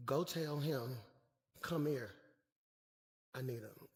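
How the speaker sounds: very soft, low aside; hushed instruction. Acted. Jesus sending his crew for the beggar, low and hushed. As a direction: drop the voice right down for a private order.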